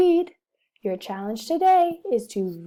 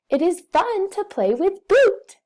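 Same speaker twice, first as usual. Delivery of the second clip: much higher and louder, bursting with fun; excited; 9 semitones higher, 6 dB louder than usual. Shown as a demonstration. Teacher modelling excited reading to the class. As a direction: sell it like playtime just started. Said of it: "we know we are excited".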